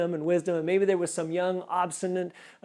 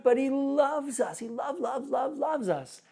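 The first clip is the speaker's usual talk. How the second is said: fervent love; 6 semitones higher, slower than usual. Real, not acted. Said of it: "preaching God's love".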